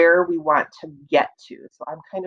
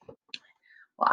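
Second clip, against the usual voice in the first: whisper; 9 dB quieter than usual. Real, not acted. Teacher whispering to young readers: whisper. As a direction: drop to a whisper.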